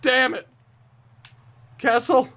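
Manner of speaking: high, exasperated begging at the game; real high-pitched gamer frustration; desperate pleading. Real, not acted. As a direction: plead for help in a strained high voice. Begging his team mid-game.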